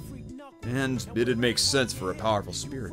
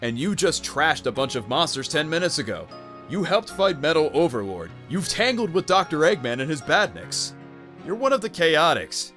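raised-pitch, emphatic encouragement; insistent pep talk; 5 semitones higher than usual. Acted. To a scared young teammate, insistent encouragement. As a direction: build the case point by point.

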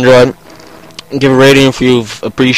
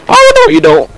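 sudden high voice crack, louder; 4 dB louder than usual. Real, not acted. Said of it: "my voice just cracked there".

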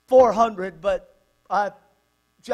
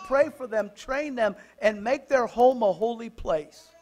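raised, insistent exhortation; impassioned preaching; 5 semitones higher, 7 dB louder than usual. Real, not acted. Preacher urging parents to raise godly children, impassioned preaching. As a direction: preach it higher, point after point.

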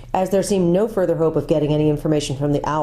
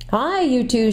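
mild raised call to wake them; loud call; 7 semitones higher, slower than usual. A narrator's reading. Jill calling to the sleeping boys, mild raised call. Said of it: "shouted Jill in a loud voice".

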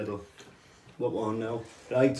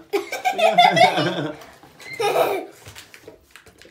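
high squealing laugh; 6 dB louder than usual. Real, not acted. Big laugh after joke.

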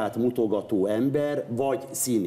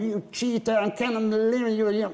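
bad American accent; 7 semitones higher than usual. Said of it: "volt egy szövegem".

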